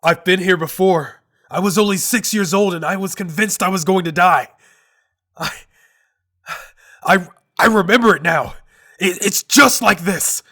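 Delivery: high, loud terrified pleading; anguished voice reliving a childhood drowning; desperate. Acted. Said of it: "The pure desperation in his voice".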